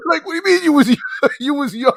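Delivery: high-pitched, laughing through the question; laughing disbelief. Real, not acted. Laughing at the woman's excuse that she was young. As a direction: laugh while asking it, can't believe it.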